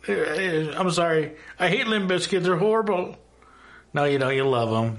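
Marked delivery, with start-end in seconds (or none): 0.1-3.2 higher flustered stammering voice; sheepish denial. Acted. Fan caught rocking out to Limp Bizkit, high flustered excuses. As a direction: stammer like someone caught red-handed.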